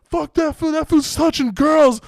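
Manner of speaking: high, heated retold shout; hammed-up accusing yell; imitated angry accusation. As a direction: shout it like backup in a brawl. Homie shouts accusation in brawl, retold.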